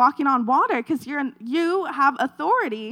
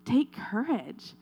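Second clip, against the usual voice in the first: soft urging; 6 dB quieter, slower than usual. Shown as a demonstration. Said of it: "So I'm telling you today".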